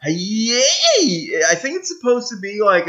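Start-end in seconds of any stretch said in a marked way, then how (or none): 0.0-1.3 high, long "yee" yell; war cry. Shown as a demonstration. Gamer trying a word they cannot pronounce, high war cry. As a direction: attempt a horde's battle cry, pitched way up. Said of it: "a war cry from".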